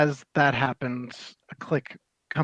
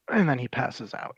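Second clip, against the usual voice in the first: ordinary talk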